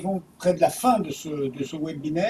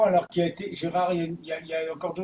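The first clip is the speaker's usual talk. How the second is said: usual speaking voice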